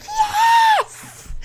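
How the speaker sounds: very high, squealed cheer; imitated audience scream. Imitating women in the audience, gleeful high squeal. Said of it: "the women in the audience just screaming".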